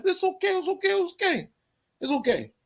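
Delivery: high sing-song sarcastic okay; mock reassurance. Sarcastic dismissal of a rival.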